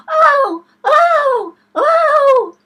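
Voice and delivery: very high, loud shrieking cries. Acted. Queen shaking her hand, shrieking in pain. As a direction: shriek each oh like a steam whistle. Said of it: "shouted the Queen".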